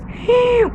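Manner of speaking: sharp, high intake of breath; surprised gasp. Acted. Teacher gasping in wonder for her pupils. Sees the king.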